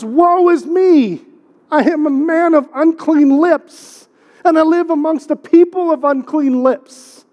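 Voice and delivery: high, drawn-out mock-prophetic cry of woe; Isaiah's lament. Preacher acting out Isaiah's response to God, high slow lament.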